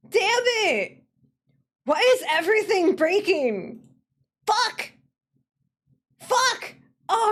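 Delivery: high, loud wails of frustration; pissed-off outburst. Real, not acted. Streamer losing the pop-up window, angry wailing outburst. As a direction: wail and curse at the top of your voice. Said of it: "I'm so pissed about that".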